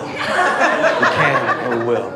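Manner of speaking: very slow and low, each word weighted; slow emphatic repeat. Acted. Comic repeating the rally vow to the ladies very slowly. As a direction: drag it out like a protest vow.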